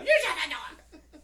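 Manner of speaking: high, loud, indignant yell; hammed-up outraged shriek; mock angry shout. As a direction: snap it like an offended wife. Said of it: "she's all".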